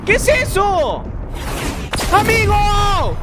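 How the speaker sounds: stretched-out outcry of disbelief; shocked yelling. Real, not acted. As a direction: yell at the screen in real shock. Surprised by something in game.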